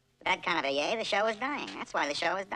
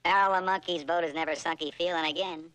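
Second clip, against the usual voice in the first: usual speaking voice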